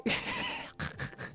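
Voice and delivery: high, breathless laugh; wheezing laugh. Real, not acted. Player breaking up over the scene, wheezing laugh. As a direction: let out a voiceless wheeze of laughter.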